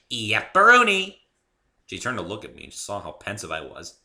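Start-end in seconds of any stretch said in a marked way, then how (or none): 0.1-1.2 high, loud bouncy pony voice; bubbly pony. A narrator's reading. Pinkie explaining Spike to the newcomer, high and bubbly. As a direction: chirp it like a cheerful pony.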